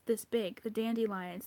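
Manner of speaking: usual speaking voice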